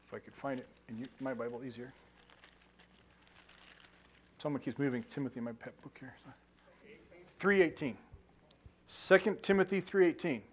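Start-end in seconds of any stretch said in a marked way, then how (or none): 0.1-6.3 much softer aside while flipping pages; under the breath, searching the Bible; quiet mumble while searching; muttering to himself. Real, not acted. Muttering while hunting the page.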